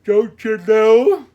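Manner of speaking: hammed-up Midwestern "don'tcha know", high, slow, very loud; Midwestern accent. A narrator's reading. Postmaster signs off her advice, shrieky exaggerated Midwestern accent. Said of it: "her Midwestern accent left no doubt".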